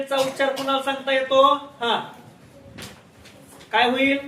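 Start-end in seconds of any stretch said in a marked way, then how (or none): none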